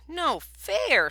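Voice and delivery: high, loud mouse squeal; a little mouse's high squeak of protest. Acted. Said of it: "squealed Mouse".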